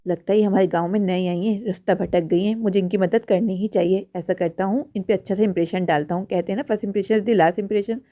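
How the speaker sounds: low, very soft inner voice; thinking to himself. Acted. Man planning to impress a stranded stranger, inner monologue. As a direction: talk privately to yourself, hushed and low.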